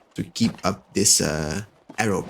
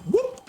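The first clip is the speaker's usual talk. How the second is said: very high, short whoop; sudden high-pitched whoop. Real, not acted. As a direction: let out a quick high "whoop". Startled whoop during gameplay.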